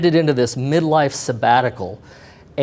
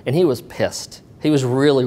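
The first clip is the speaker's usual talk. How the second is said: normal talking voice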